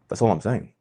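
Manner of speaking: ordinary delivery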